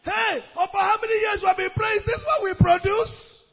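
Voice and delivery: high-pitched mock tearful protest; imitated crying outcry. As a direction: cry out in anguish, imitating the preacher. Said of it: "he started crying".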